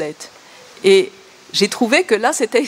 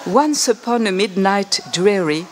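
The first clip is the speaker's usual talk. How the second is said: slow, measured English reading of Poe; poem recital; slower than usual. Shown as a demonstration. Lecturer reading Poe aloud to show its sounds, slow recital. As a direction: savour the sounds, line by line. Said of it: "c'est les sonorités".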